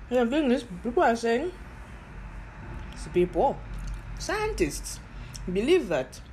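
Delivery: talking while eating; speaking around a mouthful of food; mouth full. Real, not acted. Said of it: "Sorry, I'm eating".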